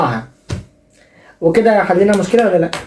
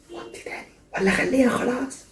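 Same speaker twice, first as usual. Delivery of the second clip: much softer, slow whispered aside to the caller; 5 semitones higher, 11 dB quieter than usual. Acted. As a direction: lean in and whisper the question.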